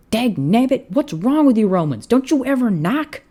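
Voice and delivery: high, loud frustrated cowboy; exasperated outburst. A narrator's reading. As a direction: blow up at the intruder. Embarrassed cowboy exploding.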